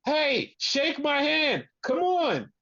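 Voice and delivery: high-pitched mock pleading; imitating Cash. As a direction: imitate a wrestler insisting on a handshake, high and drawn out. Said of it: "Cash is on the outside going".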